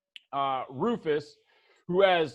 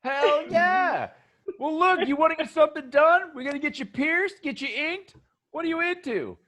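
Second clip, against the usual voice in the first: higher and quicker hustler pitch; upbeat salesman dude, higher; eager shop guy; 11 semitones higher than usual. Acted. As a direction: sell them on piercings and ink. NPC Kip pitching work.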